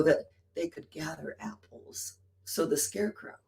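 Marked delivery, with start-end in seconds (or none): 0.0-2.1 whispered aside; hushed whisper. Retold or imitated. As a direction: drop to a breathy hush.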